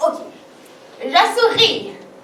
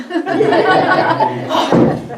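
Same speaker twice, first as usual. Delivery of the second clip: giggling at herself; embarrassed laugh; 4 dB louder than usual. Real, not acted. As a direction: burst of laughter. Laughs at own attempt.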